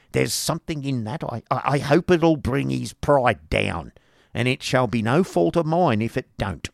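high, emphatic, spiteful wife; spiteful schoolmaster's wife. A narrator's reading. Wife hopes to humble the new teacher, spiteful. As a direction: relish taking the teacher down.

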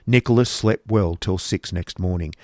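plain reading voice

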